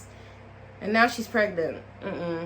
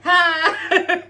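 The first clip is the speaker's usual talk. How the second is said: baffled huh; 7 semitones higher, 5 dB louder than usual. Retold or imitated. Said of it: "Mercedes is just in the back like".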